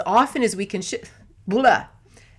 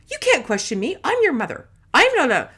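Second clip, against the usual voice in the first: higher-pitched, indignant mother voice; a hint of an affronted parent; scolding parent; 6 semitones higher, 3 dB louder than usual. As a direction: lightly play a mother pulling rank. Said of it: "could have been a parent saying".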